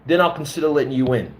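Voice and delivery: mocking impression of a pushy coordinator; mock smug coordinator. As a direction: mimic him as a smug gatekeeper. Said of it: "I'm like".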